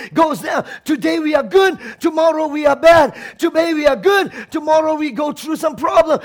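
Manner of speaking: ordinary talk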